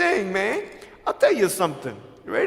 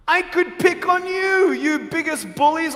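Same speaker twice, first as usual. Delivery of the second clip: raised voice; 9 semitones higher, 4 dB louder than usual. Real, not acted. Speaker taunting bullies, raised voice.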